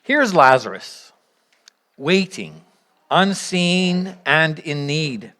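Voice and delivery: usual speaking voice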